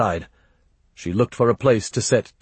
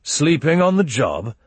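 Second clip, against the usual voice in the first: higher-pitched accusing question; demanding; 7 semitones higher, 3 dB louder than usual. A narrator's reading. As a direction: catch them out with a rising challenge. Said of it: "he demanded".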